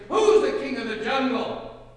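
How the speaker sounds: very loud, high snarl; booming, menacing demand; snarling lion. A narrator's reading. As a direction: snarl the question as a bullying lion. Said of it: "he snarled".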